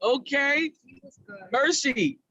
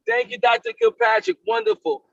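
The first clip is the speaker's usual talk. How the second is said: enthusiastic thanks; 9 semitones higher, 3 dB louder than usual. Real, not acted. Host thanks the presenter brightly.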